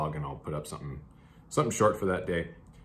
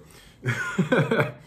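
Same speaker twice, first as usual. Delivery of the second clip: laughing; 10 semitones higher, 6 dB louder than usual. Real, not acted. Laughs at the receipt hunt.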